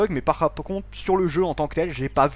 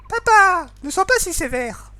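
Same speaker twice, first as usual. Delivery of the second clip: higher, louder little sister pleading; high girl voice; 13 semitones higher, 5 dB louder, slower than usual. Acted. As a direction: plead with papa in a girlish voice. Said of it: "faire des voix un petit peu particulières".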